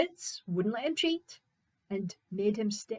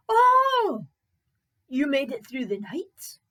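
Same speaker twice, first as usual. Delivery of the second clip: high, loud cry of surprise; astonished servant; 5 semitones higher, 11 dB louder than usual. Acted. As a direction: gasp it out, amazed he is alive. Servant astonished Fritz is alive.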